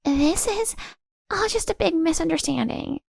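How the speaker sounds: high, pleading woman's voice; Tracy denying. Acted. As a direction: brush it off in a high voice.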